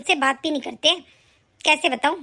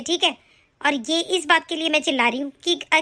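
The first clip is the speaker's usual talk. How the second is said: ordinary delivery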